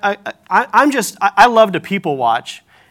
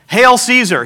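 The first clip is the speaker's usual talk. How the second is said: loud, raised mock hail to a ruler; mock royal salute; 6 semitones higher, 5 dB louder, slower than usual. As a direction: call it out like a crowd hailing an emperor. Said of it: "He could have said".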